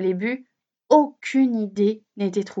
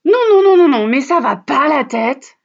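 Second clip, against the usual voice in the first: loud protest; 8 dB louder than usual. Acted. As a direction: cut him off, outraged. Fox protests, Santa gives up. (protestation beaucoup plus forte)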